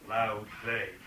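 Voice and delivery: "loud glade" both words stressed equally; equal stresses. Shown as a demonstration. Lecturer naming the spondee, both words weighted. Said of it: "a true spondee".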